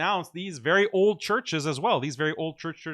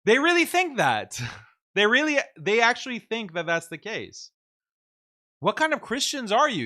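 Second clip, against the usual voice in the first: incredulous laughter; 8 semitones higher, 5 dB louder than usual. Real, not acted. Laughing disbelief at the audience.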